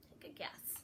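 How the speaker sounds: soft whispered coaxing. Real, not acted. Reader whispering to the children to guess.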